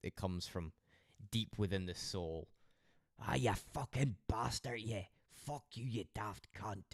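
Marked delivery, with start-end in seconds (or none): 3.2-6.8 a touch of thick Scots cursing; broad Scottish swearing. Pretend. Showing how he sounds pissed off, mild broad Scottish swearing. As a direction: let the broad Scots come out. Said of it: "I don't try and speak proper".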